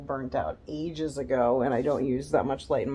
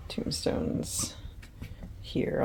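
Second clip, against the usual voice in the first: whisper; 11 semitones lower, 8 dB quieter, much slower than usual. Real, not acted. Whispered while filming.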